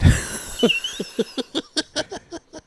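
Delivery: airy, high wheeze of laughter; breathy high laugh. Real, not acted. Patient laughing at the doctor's comment, airy high laugh. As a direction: let out a soundless high laugh.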